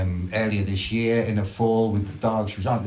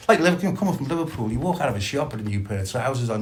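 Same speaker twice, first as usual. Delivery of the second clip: ordinary delivery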